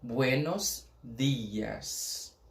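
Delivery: buenos dias very slow, dias with a plain d, not dj; slow Spanish, hard d. Shown as a demonstration. Teacher models dias with a Spanish d. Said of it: "não tem esse di".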